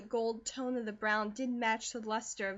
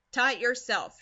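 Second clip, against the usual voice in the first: curt, louder order; brusque mother, louder; said shortly; 8 dB louder than usual. A narrator's reading. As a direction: cut her off short. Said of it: "she said shortly".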